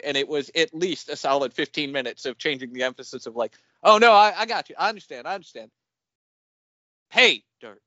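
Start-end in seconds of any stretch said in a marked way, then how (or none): 3.8-5.7 loud, high, over-keen agreement; clueless actor insisting he has it; imitated eager actor. 7.1-7.8 actor overdoing the first word, "dirt" thrown away; imitating shifted emphasis. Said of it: "he would change the emphasis around".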